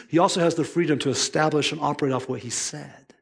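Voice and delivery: ordinary delivery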